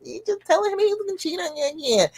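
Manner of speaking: put-on judgmental squeal, kept small; mocking critic. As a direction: mimic the people you can't stand in a high voice. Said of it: "I hate it when people say that".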